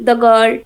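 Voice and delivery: put-on "the girl" with the said "da". Shown as a demonstration. Teacher showing a second consonant example, said as da. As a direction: say "the" in "the girl" as da, not dee. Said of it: "তখন সেটাকে আমরা দা বলবো".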